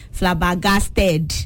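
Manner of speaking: "Flabbergasted" slowly as fla-ba-gas-TED, full final e; Nigerian pronunciation. Shown as a demonstration. Host showing the Nigerian way, "Flabbergasted" with a full -ted. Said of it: "like, the Nigerian way".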